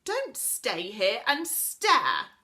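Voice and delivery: loud, slow shouted order. A narrator's reading. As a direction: bellow it at him in a fury, word by word. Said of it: "shouted Connie".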